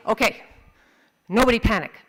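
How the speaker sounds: very loud, firm order; commanding dad. Acted. Dad to family with injured dog, loudly taking charge. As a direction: bark it out, pausing after the first word.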